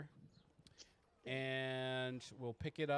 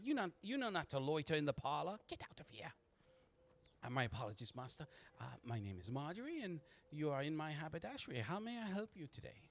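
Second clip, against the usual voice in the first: lilting, higher woman's voice; genteel haberdasher madam; 5 semitones higher, faster than usual. Acted. Marjorie receiving a customer in her haberdashery, genteel and lilting. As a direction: scold the girls, then politely welcome the customer.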